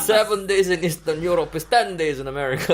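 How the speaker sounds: put-on loud laughing Eastern European accent; Russian accent. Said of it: "now say that again in Russian accent".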